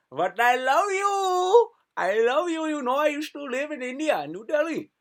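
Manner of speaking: high, loud, hammed-up Indian accent; terrible Indian accent. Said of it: "That's a terrible accent".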